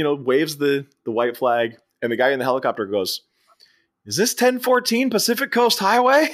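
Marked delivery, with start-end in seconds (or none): 4.0-6.3 high-pitched call from afar, imitated; mock calling out. Guy in the helicopter asks for an address, high mock shout.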